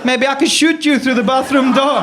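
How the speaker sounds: a scary over-the-top South African helper; South African accent. Pretend. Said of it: "by pretending to be South African".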